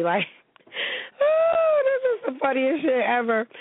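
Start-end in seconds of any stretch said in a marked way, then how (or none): 0.7-3.5 high burst of laughter; real laugh breaking in. Real, not acted. Laughing at funny story.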